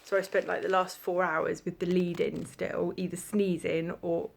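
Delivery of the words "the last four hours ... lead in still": ordinary talk, nothing marked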